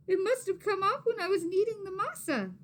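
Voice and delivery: high, loud panicked realisation; panicked thought. A narrator's reading. Maria discovering ring lost in tamales, high panic. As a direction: gasp it out in alarm. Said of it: "she thought".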